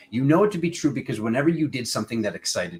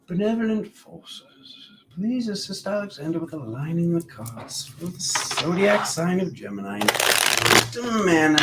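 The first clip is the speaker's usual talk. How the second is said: raised, solemn call to the spirits; invocation; 8 semitones higher than usual. Acted. Invokes spirits before reading.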